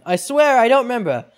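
high, loud desperate plea; pleading accused. Acted. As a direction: swear innocence in a panic.

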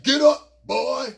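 very loud order; gruff command. Retold or imitated. Cannon ordering the narrator up. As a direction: bark him to his feet. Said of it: "He like".

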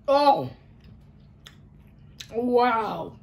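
sudden loud wow; surprised. Real, not acted. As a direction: react to a shock of sour. Tasting the sourest candy.

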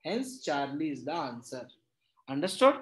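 ordinary talk, nothing marked

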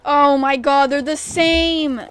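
loud, drawn-out disbelief; shocked outcry. Real, not acted. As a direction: exclaim it loudly, stretching the last words. Shocked that the horses are unchanged.

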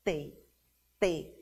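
a softened, isolated "T" letter name; T menos intenso. Shown as a demonstration. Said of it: "mais, menos intenso". (T brando, menos intenso)

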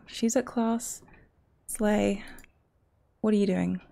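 ordinary talk

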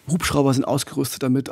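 ordinary talk, nothing marked

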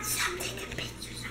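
whispered aside; murmured aside, barely voiced. Real, not acted. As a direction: hush it under your breath. Whispered aside to camera holder.

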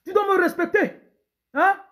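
loud, high demanding husband; bossy husband. Acted. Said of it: "Un pauvre autoritaire". (mari autoritaire qui crie)

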